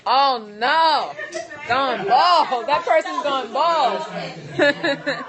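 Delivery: loud, slow, laughing through words. Real, not acted. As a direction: crack up while saying it. Reacting to a viewer comment.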